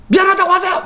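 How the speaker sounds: high-pitched indignant order; imitated angry demand. Driver demands an apology, angry high outburst. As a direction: mimic an irate driver demanding. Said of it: "화를 내는 거예요".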